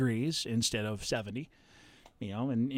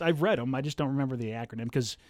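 ordinary talk

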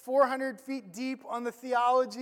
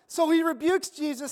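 ordinary delivery

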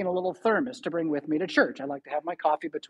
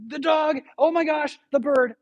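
excited daughter; 8 semitones higher than usual. Retold or imitated. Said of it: "she goes".

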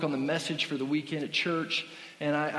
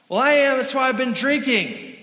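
much higher and louder, a put-on nervous woman; high, loud impression of a drunk lady on a plane; tipsy lady voice; 9 semitones higher, 9 dB louder than usual. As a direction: play the tipsy passenger who blurts it out. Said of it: "she goes".

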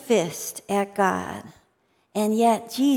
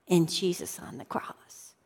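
hushed whisper; 3 semitones lower, 9 dB quieter than usual. Real, not acted. Confessing his own part, moved.

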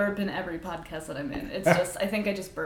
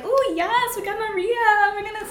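excited; 14 semitones higher, 5 dB louder than usual. Retold or imitated. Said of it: "We were like".

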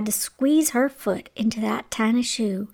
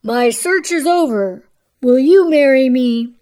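louder, elated storybook prince; happy cry; 7 dB louder than usual. A narrator's reading. Prince proposes to Cinderella, crying happily. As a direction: propose aloud with joy. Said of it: "he cried happily".

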